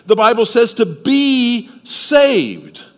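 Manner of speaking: slow down and lean on "be saved"; slow, emphatic preaching. Acted. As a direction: press each word of "to be saved".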